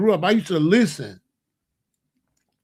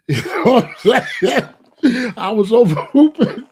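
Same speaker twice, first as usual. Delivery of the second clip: higher, louder, breaking into laughter; laughing while talking; 3 semitones higher, 6 dB louder than usual. Real, not acted. Telling how revolutionaries balked at him, cracking up. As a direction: talk through a real laugh.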